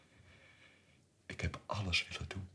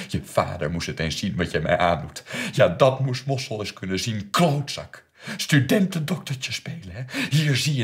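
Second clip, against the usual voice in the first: loud, higher furious tirade; raging abuse; 5 semitones higher, 14 dB louder than usual. Retold or imitated. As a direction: scream insults at the man. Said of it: "heb ik toen geroepen". (luide, woedende scheldtirade)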